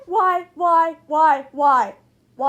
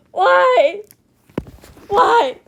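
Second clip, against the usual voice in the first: ordinary delivery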